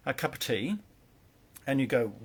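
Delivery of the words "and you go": ordinary talk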